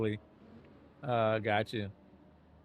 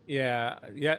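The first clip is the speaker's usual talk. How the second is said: usual speaking voice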